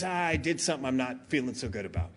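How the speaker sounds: put-on low, quiet and rushed, a guilty kid; guilty low mumble. Daughter guiltily confessing.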